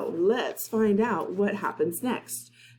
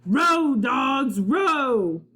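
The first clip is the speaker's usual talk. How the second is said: a captain yelling at his crew to row; pirate shout; 7 semitones higher, much slower than usual. Acted. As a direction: bark the crew on over the waves. Captain yells at rowing crew.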